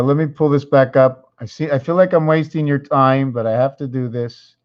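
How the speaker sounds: usual speaking voice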